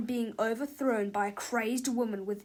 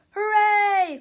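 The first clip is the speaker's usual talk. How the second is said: loud, high, jubilant cry; one long gleeful whoop; children's cheer; 10 semitones higher, 10 dB louder than usual. A narrator's reading. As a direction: cheer like a class of kids. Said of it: "cried the children".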